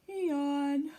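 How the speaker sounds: yawning out the words. A narrator's reading. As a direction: stretch it into a big yawn. Porcupine yawns.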